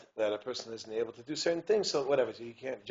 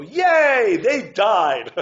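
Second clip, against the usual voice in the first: high, loud mock cheer; 9 semitones higher, 17 dB louder, much slower than usual. Preacher mocking joy at a death, loud cheer. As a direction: cheer absurdly at bad news. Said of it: "you don't go".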